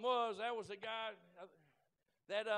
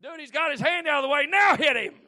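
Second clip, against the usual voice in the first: egging on; 5 semitones higher, 15 dB louder than usual. Retold or imitated. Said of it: "it's like".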